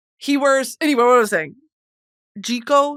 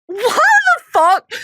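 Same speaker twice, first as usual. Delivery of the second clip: high, loud and slow, utterly baffled; incredulous outburst; 9 dB louder, slower than usual. Real, not acted. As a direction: stretch out the disbelief. Baffled by the casting.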